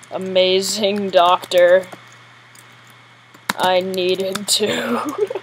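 ordinary delivery

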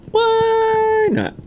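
cartoonish high echo; 1 squeaky high repeat. Shown as a demonstration. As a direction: squeak it out, playful. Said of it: "'Cause why not?".

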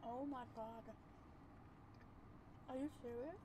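chewing while talking; muffled through food; mouth full. Real, not acted. Seen 0: Bite at -9, chewing with closed lips and hand to mouth until about 6.4.

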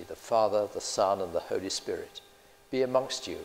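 usual speaking voice

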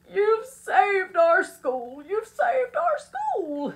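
high, loud, drawn-out cry of triumph; slow, exultant shout; jubilant roar. Acted. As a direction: roar it with overflowing joy. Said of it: "he jubilantly roared".

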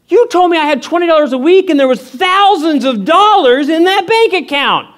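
high-pitched comic outrage; hammed-up disbelief at Dad; indignant accusation. Retold outraged confrontation with dad.

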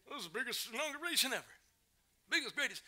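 high-pitched, quick mock boast; Donald Trump. Mimicking Trump boasting about his inauguration, high mock boast. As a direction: crow it high, as a hammed-up quote. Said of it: "Member of Trump?".

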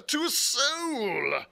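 high, loud, long-drawn wail of protest; indignant cry. A narrator's reading. Squire denying he leaked the secret, wailing indignantly. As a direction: cry it out, stretching every word. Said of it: "cried the squire".

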